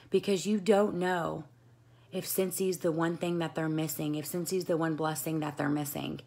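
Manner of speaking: usual speaking voice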